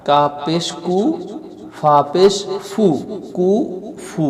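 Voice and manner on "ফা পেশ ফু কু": ordinary delivery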